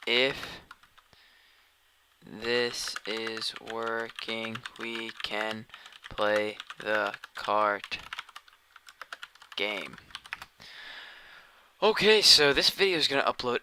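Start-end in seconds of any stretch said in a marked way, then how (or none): 0.1-10.0 stretched words with long gaps; slow, word-by-word. Real, not acted. Asking if the stream works, slow word-by-word. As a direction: dictate it slowly to yourself while typing. Typing out a message aloud.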